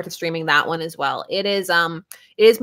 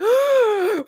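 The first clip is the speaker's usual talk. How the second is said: mock panicked gasp; 13 semitones higher than usual. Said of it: "I was like".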